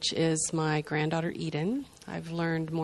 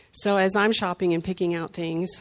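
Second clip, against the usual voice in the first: usual speaking voice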